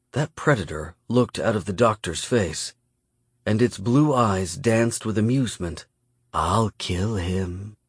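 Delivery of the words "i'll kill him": slow, soft, drawn-out threat; menacing Miyamune. A narrator's reading. Predator threatens to kill a hostage, soft menace. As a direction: threaten quietly, taking your time.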